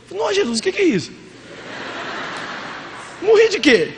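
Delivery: high, fast, bewildered questions; mock astonishment. Pastor asks Jesus how he died, mock bewildered. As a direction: play it as baffled to find yourself dead. Pastor arriving in heaven puzzled.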